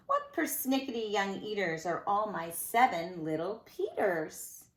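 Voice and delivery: ordinary delivery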